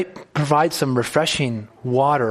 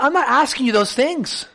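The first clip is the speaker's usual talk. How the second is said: exasperated Job; 9 semitones higher than usual. Retold or imitated. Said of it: "Job's like".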